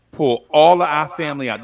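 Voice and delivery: ordinary talk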